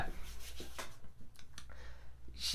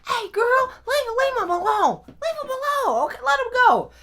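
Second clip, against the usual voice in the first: very high, raised voice calling her off; urgent high intervention; 5 dB louder than usual. Retold or imitated. Stepmom pulling mom off the boy, high urgent shout. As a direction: imitate a woman breaking up a whooping.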